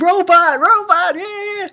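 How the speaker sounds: put-on very high squealed kid chant; excited boys. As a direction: imitate little boys freaking out with glee. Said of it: "They're so excited".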